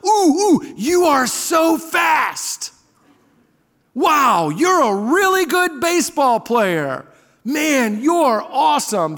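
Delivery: high, gushing shouts of admiration; imitated encouraging kid. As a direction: imitate a little boy hyping up his teammate, stretching the praise. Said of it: "he's a natural encourager. He says things like".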